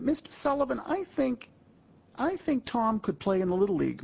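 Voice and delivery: put-on high, eager young boy pleading; a boy asking Mr. Sullivan a favor; boy's voice. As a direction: pitch up like a hopeful kid. Kid Billy addressing Tom's father.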